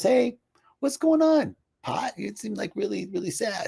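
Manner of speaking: a lightly voiced caring worker; concerned water carrier. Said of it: "he goes".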